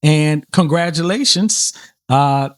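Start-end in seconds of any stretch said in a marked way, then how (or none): none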